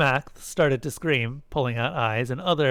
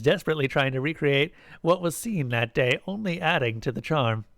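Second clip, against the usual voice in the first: ordinary delivery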